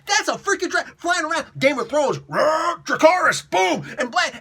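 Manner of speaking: high, loud mock freak-out at a dragon; hyper outburst. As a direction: shout it with roaring sound effects, hyped up. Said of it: "getting like, really hyper".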